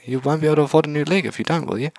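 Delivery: put-on high, bright girlish voice; cheerful robot girl voice. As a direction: chirp it like a cute robot. Robot speaks.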